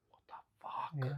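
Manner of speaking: slow breathless whisper of disbelief; stunned whisper. Retold or imitated. Dad recalling his son's reaction, slow shocked whisper. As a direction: mouth it slowly like a shocked kid. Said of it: "my son just looked at us like".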